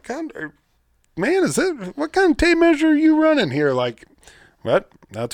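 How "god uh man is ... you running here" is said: mock disbelief. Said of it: "it's like".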